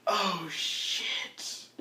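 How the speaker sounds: faint, drawn-out "oh, shit"; weakly, as if badly wounded; very soft, slow-dawning groan; dying doppelganger. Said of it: "the doppelganger's like".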